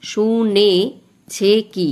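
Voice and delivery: very slow, each syllable of the line drawn out; teacher counting the beats of the verse slowly for students. Shown as a demonstration. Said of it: "এইভাবে আমি মাত্রাগুলো দিয়ে ফেলেছি".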